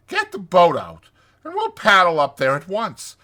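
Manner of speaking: loud, high, hearty voice; rally them with gusto; hearty enthusiasm. Acted. Rat urges Mole to visit Toad, hearty.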